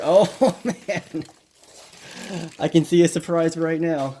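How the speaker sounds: loud, high, laughing delight; thrilled surprise at the unboxing; excited. Real, not acted.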